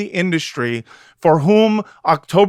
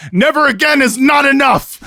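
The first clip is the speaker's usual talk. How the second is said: higher and louder, proclaiming the title; mock proclamation; 9 semitones higher, 4 dB louder than usual. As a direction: announce the title like a slogan. Reads book title incredulously.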